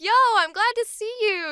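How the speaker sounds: bright, high friendly hello; cheery greeting. Retold or imitated. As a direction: greet a friend you're glad to see. Voicing the character's greeting.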